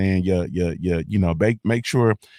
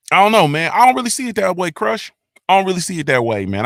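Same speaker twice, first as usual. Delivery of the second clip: heated disagreement; 9 semitones higher, 4 dB louder, faster than usual. Real, not acted. Disagreeing with Crush's chat comment.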